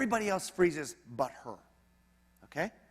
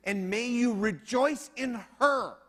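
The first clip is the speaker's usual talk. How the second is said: emphatic preaching; 7 semitones higher, 5 dB louder than usual. Acted. Preacher urging husbands.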